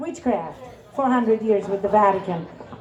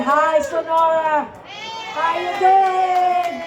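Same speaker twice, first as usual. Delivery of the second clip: drawn-out greeting; 5 semitones higher, much slower than usual. Real, not acted. Greeting the crowd.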